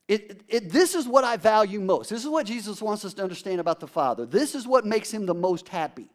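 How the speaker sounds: usual speaking voice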